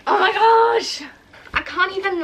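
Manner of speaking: overwhelmed with joy; excited. Real, not acted. Books arrive.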